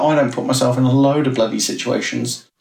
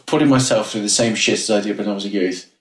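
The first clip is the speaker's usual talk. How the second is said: normal talking voice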